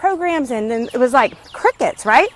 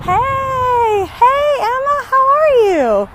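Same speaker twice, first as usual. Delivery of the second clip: much higher, slower hello across the street; cheery call-out; 11 semitones higher, 3 dB louder, much slower than usual. Real, not acted. Vlogger spots neighbor Emma on her walk, high cheery call. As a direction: sing out a friendly greeting. Greeting a neighbor while walking.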